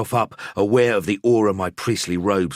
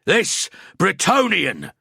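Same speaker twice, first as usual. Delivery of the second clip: slow, high, thundering denunciation; wrathful proclamation; 12 semitones higher, 4 dB louder, slower than usual. A narrator's reading. Priest denouncing the killer before the crowd, thundering wrath. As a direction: call down the wrath of the gods. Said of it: "a voice like the wrath of the gods".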